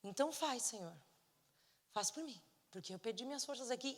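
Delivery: understated acting of a weepy prayer; tearful surrender. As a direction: give in quietly as you pray. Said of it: "E aí chorei".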